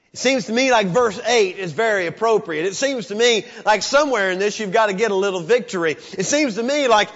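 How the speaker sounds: loud, high preaching cry; fired-up preacher; full-force preaching. Acted. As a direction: preach it to the back row.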